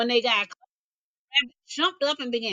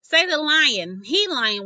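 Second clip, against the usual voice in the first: ordinary delivery, nothing marked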